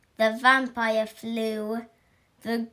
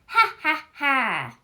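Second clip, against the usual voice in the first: mocking laugh; 2 semitones higher, 5 dB louder than usual. Classmates laugh at howl.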